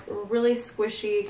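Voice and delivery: usual speaking voice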